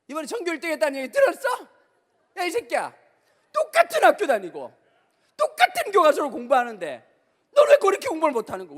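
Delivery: high, loud furious scolding, fast; nagging mom yelling. Retold or imitated. Mother yells at her child comparing him to a top student, loud and high. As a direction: yell at the kid for bad grades.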